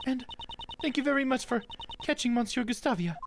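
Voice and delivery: high-pitched, remorseful character voice; apologetic game character. Acted. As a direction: lift the voice high for a sincere apology.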